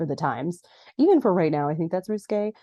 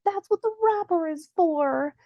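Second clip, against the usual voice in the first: high-pitched mock exasperation; hammed-up high wail of frustration; upset; 11 semitones higher than usual. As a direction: imitate someone upset, voice pushed way up. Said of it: "And she's so upset".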